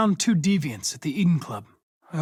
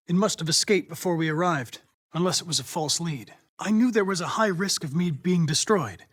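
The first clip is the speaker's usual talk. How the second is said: ordinary talk, nothing marked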